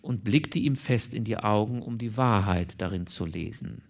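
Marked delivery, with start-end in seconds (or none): none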